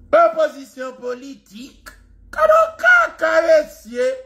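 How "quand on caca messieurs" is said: mocking shout. Real, not acted. Mocking call to listeners.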